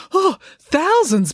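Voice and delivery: high, loud, drawn-out panic; panicked. A narrator's reading. Dad learning of the crowd size, panicked. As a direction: gasp it out in dismay. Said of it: "panicked Dad".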